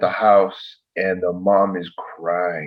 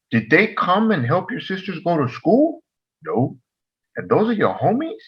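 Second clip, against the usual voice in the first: put-on pitched-up, rhetorical questioning of the kid; incredulous lecture; 8 semitones higher than usual. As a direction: hammer home each rhetorical question.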